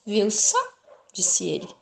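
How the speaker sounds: ordinary delivery, nothing marked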